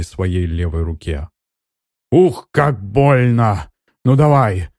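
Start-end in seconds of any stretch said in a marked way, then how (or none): 2.1-4.7 high, loud pained cry. A narrator's reading. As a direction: yell through the sting of the cut. Mage cuts own arm.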